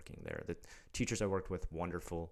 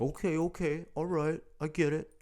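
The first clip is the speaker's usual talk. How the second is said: flustered cop; 11 semitones higher, 7 dB louder than usual. Retold or imitated. Cop backing down when shown card.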